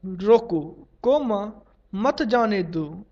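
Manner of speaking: रोको alone, "coma" spoken as the break; pause after रोको. Shown as a demonstration. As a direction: break after रोको, then मत जाने दो as one group. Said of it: "स्पष्ट वाक्य".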